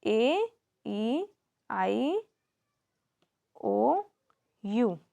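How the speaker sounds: ए, ई, आई, ओ, यू as separate drawn-out letter names; each of ए, ई, आई, ओ, यू held and set apart; vowel sounds demo. Shown as a demonstration. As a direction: recite the vowels ए, ई, आई, ओ, यू one by one with pauses. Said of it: "vowel sounds क्या होती हैं?".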